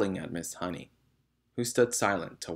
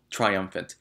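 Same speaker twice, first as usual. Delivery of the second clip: plain reading voice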